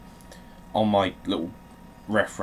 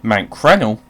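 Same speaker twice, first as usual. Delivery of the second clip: loud surprise; 13 dB louder than usual. Real, not acted.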